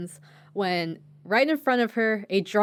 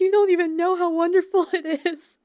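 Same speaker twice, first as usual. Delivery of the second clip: put-on much higher, louder, wailing drunk; teary drunk lady; 11 semitones higher, 6 dB louder than usual. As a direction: gush through tipsy tears. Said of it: "gets really teary and is like".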